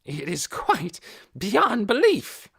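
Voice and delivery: loud, high indignant ranting; railing. A narrator's reading. Hoyt railing at Missus Beeson before the officers, indignant. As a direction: rant in rising indignation. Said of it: "Hoyt railed on".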